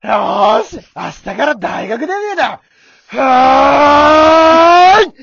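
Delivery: loud, fired-up cheer ending in a long high cry; eager debut shout. Shown as a demonstration. Trying the bit for his friend, fired-up cheer. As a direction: throw yourself into it. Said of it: "本気でやってみっか".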